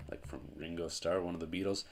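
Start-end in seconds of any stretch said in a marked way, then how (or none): none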